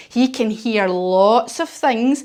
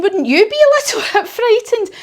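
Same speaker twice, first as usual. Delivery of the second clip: much higher, louder teasing question; playful high question; 12 semitones higher, 5 dB louder than usual. Real, not acted. Teacher asks children playfully.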